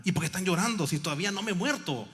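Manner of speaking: the sister scolding the crying pastor, loud and high; sister rallying. Retold or imitated. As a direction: lift the weeping men with a cry. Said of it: "me dice la hermana".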